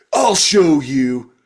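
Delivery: mock petulant tough-guy threat; hammed-up defiant vow; gruff action hero. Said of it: "talks like a gruff action hero".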